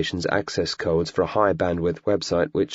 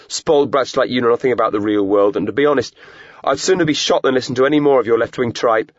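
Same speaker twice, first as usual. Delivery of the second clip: loud, higher furious tirade; angry rant; 5 semitones higher, 6 dB louder than usual. A narrator's reading. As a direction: let the fury pour out. Hostage ranting at captor.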